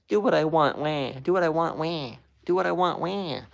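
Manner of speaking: put-on high, loud whining toddler voice; whiny Trump impression. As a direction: whine it like a spoiled kid demanding things. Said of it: "Trump just says".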